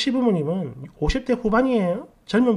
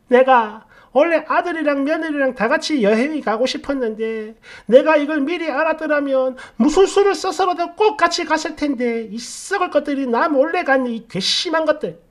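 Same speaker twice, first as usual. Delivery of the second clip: higher-pitched, wailing mock complaint; hammed-up resentful grievance, pitched up; indignant mother-in-law; 6 semitones higher than usual. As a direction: act out an aggrieved mother-in-law scolding.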